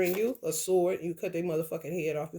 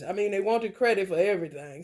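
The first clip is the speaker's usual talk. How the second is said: usual speaking voice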